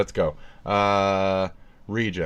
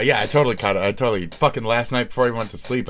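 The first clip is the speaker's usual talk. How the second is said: usual speaking voice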